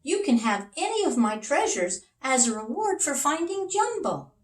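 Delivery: high, generous king; king's voice. Acted. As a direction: offer it grandly as a happy king. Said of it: "the king said".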